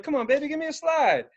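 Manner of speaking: put-on high coaxing baby talk; coaxing a cat. Asking the cat for a slide, high coaxing. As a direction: coax the cat for a paw.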